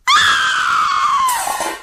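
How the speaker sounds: sudden piercing high scream of terror; frightened scream. Acted. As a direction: shriek as if seeing a ghost. Startled woman screams at ghost.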